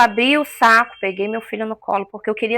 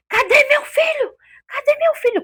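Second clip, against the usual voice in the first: desperate cry. Retold or imitated. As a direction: cry out for the lost son, pitched up high. Mother demanding her son. (grito desesperado de mãe, bem agudo)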